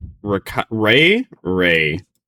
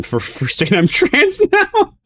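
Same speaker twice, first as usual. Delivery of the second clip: laughing; slower than usual. Real, not acted. Laughing at own joke.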